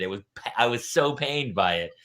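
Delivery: ordinary delivery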